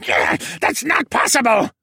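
very high, loud penguin screech; screeching denial. A narrator's reading. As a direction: screech it while struggling. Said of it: "he screeched and began to struggle".

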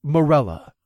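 warning. Acted. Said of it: "I warned".

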